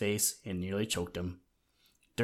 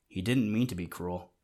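plain reading voice

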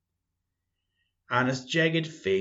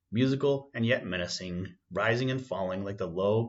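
ordinary delivery, nothing marked

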